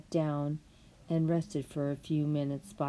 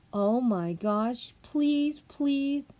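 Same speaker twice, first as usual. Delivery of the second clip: put-on begging girl; 6 semitones higher, much slower than usual. Alice begs again.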